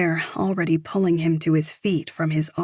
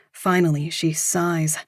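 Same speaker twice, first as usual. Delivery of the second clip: ordinary delivery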